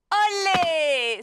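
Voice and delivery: spirited olé. Acted. Teacher shows castanets with a shouted olé. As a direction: cry out olé with flair like a flamenco call.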